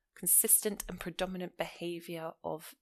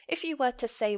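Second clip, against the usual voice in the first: ordinary talk, nothing marked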